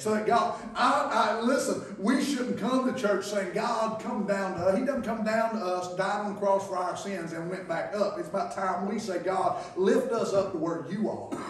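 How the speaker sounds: loud, high, ringing preacher's cry; shouting sermon in full flow; preaching at full force. Acted. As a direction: whip the congregation up from the pulpit.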